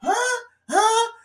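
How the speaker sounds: put-on clueless teen who can't parse it; mock confusion. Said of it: "And I'm just like".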